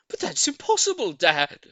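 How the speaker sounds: very high, loud squeak of disbelief; small monkey crying out. A narrator's reading. Small monkey protesting to its dad, high squeal. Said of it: "cried one of the small monkeys".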